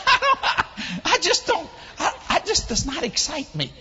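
words through high laughter; laughing. Real, not acted. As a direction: talk while still laughing.

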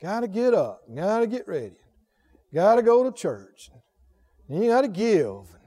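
low-energy imitation of a reluctant churchgoer; put-upon mock grumbling; mock weariness. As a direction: drone through a list of chores, weary. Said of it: "what a weariness it is".

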